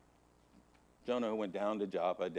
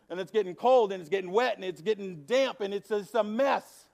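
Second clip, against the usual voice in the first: louder, driving sermon voice; fervent pulpit storytelling; preaching at full force; 8 semitones higher, 8 dB louder than usual. Real, not acted. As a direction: preach the cave story with rising urgency. Preaches the cave parable loud.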